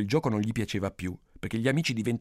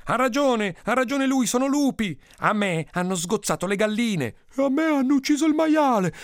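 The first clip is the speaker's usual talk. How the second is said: high, loud indignant complaints; angry villagers; 11 semitones higher, 5 dB louder than usual. Acted. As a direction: pile on grievances, pitched up and heated. Villagers angrily pile up complaints. (proteste indignate e acute)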